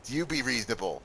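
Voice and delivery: ordinary delivery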